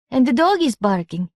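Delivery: put-on high, eager child's voice; small boy. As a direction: pipe up like a little kid.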